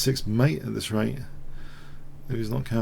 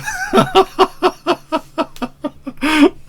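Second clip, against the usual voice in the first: squeaky uncontrollable giggling; much higher giggling burst; high giggle fit; 5 dB louder than usual. Real, not acted. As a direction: break into a high helpless giggle. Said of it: "I don't know why I'm laughing".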